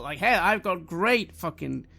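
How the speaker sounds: ordinary talk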